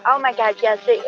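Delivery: ordinary talk, nothing marked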